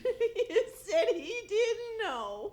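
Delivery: slow words broken up by laughter; amused, laughing as she reports it; laughing while talking. Real, not acted. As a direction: giggle your way through the line. Wife laughs retelling Rush's answer.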